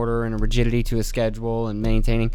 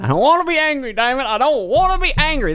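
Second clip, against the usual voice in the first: whiny frustration; 13 semitones higher than usual. Real, not acted. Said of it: "I'm getting angry again".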